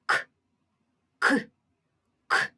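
К alone as a short burst, repeated with pauses; separate, abrupt К sounds; isolated sound. Shown as a demonstration. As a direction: pop out a single short К each time. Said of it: "Перший звук К".

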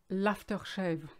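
"l'aftershave" in a French accent; French-accented loanword. Shown as a demonstration. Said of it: "You see the difference with the accent".

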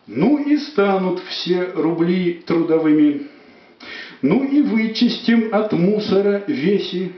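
rhythmic dramatic poem reading; poetry declamation. Acted. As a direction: declaim the verse with feeling. Poet declaims own poem.

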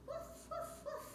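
high quiet puppy woofs; soft dog barks. Pretend. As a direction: bark softly like a puppy. Playing a dog again.

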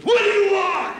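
high, loud comic yell; surly teenage shout. Acted. Teenage larva to the bee feeding it, surly shout. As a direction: blare it like a put-upon teenager.

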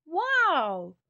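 gasped amazement. A narrator's reading. Boy meeting a talking turtle, gasped amazement. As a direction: let out an amazed wow as the boy. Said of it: "gasped the boy".

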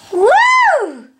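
high whooping cheer. Real, not acted. Mom whoops to celebrate her baby's potty success. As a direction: let out a big high woo.

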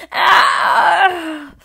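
loud high yell of pain; pained scream. A narrator's reading. Husband yelling at wife's yank, loud scream. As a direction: scream as the hat is yanked. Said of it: "he screamed".

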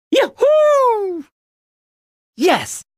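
slow, high, stretched-out yahoo; long joyful whoop. Acted. Hero shouting with joy mid-ride, long high whoop. Drawn-out whoop while riding.

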